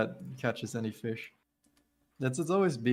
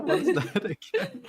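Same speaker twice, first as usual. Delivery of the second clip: high giggly question through laughter; laughing; 4 dB louder than usual. Real, not acted. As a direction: squeal it out mid-laugh. Laughing at a silly word.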